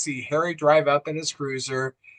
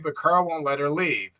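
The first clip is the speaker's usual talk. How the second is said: normal talking voice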